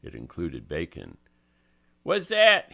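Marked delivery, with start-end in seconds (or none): 2.0-2.7 loud, high demand. Acted. Angelo demands to know what Francie brought, loudly. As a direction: bark the question at her. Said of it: "he demanded".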